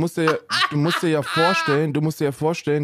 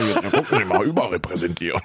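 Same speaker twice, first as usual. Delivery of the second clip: much lower, fast mock pundit voice; laughing through a low put-on voice; mock know-it-all; 8 semitones lower than usual. Host imitating the pundit with his statistics, low mock voice. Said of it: "Polizeistatistik dabei".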